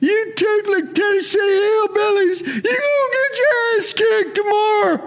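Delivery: high-pitched wailing caricature of a rowdy fan; hammed-up, screechy mock heckling, far above normal voice; jeering Georgia fan. Georgia fan taunting Tennessee before the game, hammed-up jeering wail. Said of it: "Number one is kinda like this".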